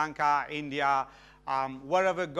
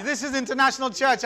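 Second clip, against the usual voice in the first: rousing preacher; 5 semitones higher, 5 dB louder than usual. Real, not acted.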